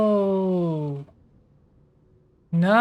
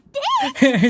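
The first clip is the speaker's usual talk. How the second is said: high burst of laughter. Real, not acted. Streamer laughs at game line.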